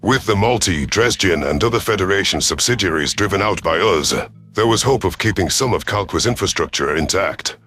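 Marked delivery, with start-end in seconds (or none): none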